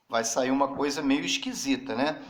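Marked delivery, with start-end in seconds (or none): none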